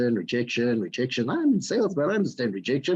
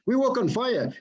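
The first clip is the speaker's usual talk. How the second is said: ordinary talk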